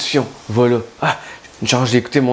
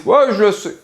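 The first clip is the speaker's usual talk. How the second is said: know-it-all; 8 semitones higher, 4 dB louder than usual. Retold or imitated. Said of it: "en croisant les bras".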